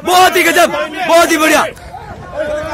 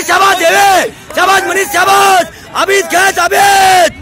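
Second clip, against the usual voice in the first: coach's shouted cheering; 4 semitones higher than usual. Real, not acted. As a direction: keep yelling praise to drive the team on. Coach shouting encouragement over training. (ज़ोर से हौसला बढ़ाती coach की पुकार)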